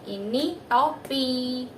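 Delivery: put-on gentle, drawn-out prompt to a toddler; slow guiding words. Shown as a demonstration. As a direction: lead his finger to the card. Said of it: "arahkan ke gambar topi".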